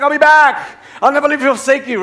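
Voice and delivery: high, hurried shout over the shoulder; calling back. Acted. Husband running out to the store, calling back. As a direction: call it up high to her.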